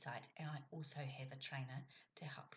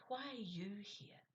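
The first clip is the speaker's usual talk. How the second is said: "you" lifted higher and drawn out; stress on "you"; 4 semitones higher, slower than usual. Shown as a demonstration. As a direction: ask slowly, leaning on "you". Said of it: "I could have said it like".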